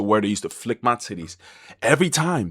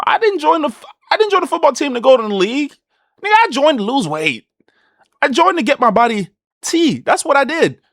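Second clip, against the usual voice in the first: much higher, a bit louder, insistent; emphatic retelling; 13 semitones higher, 4 dB louder than usual. Retold or imitated. Telling friends why he joined football, high insistent. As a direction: set your friends straight, fired up. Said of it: "I tell my friends all the time".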